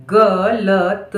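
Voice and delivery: very slow dictation; syllable by syllable. Shown as a demonstration. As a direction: stretch each sound of गलत. Dictating word sound by sound.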